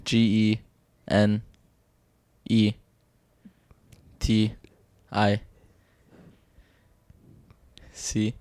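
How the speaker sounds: quiet letters with long pauses; slow hesitant spelling. Real, not acted. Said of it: "No, spell genetics".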